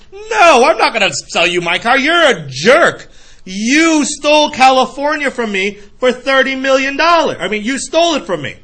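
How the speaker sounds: mock furious refusal. Angry car owner refusing.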